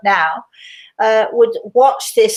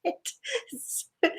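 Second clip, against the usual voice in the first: playful reply; 8 semitones higher, slower than usual. Retold or imitated. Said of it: "I said".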